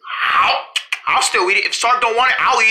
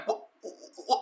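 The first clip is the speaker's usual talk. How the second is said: usual speaking voice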